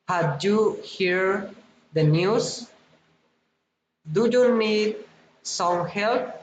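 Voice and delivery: each question lifts at its end, up on "book", "news", "help", "pen"; rising general questions. Shown as a demonstration. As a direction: let "pen" climb as a yes-no question. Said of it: "rising intonation".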